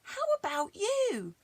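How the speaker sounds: put-on high little girl voice; Mary turning to Peter, high and young; Mary's voice. A narrator's reading. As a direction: ask it up high like a small girl. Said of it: "said Mary".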